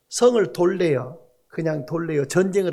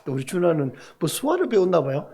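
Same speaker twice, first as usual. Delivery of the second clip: usual speaking voice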